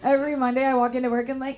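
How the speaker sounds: ordinary talk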